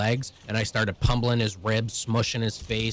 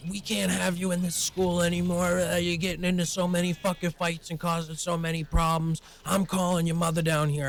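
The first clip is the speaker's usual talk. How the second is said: higher-pitched mock scolding; imitated yelling principal; 8 semitones higher than usual. Principal yelling at student after a fight, imitated scolding. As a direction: act out a principal losing her temper. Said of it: "she's yelling at me".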